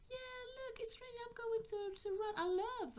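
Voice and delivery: put-on high, sing-out child's delight; excited child. Child eager to run in the rain, excited high voice. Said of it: "She's like".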